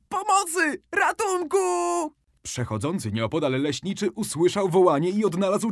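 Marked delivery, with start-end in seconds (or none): 0.1-1.6 very high, terrified cry for help; a little girl fleeing the wolf, screaming. A narrator's reading. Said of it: "krzycząc". (przerażony krzyk o pomoc, bardzo wysoko)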